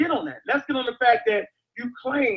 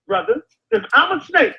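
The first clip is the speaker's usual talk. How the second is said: ordinary talk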